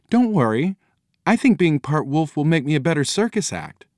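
put-on high-pitched, earnest girl's voice; young daughter, reassuring. A narrator's reading. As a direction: reassure the parents in a high child voice.

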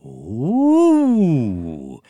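long rising awed "ooh"; awed "Ooh" chorus. A narrator's reading. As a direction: marvel like a crowd of eggs. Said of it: "said the Eggy Thingies".

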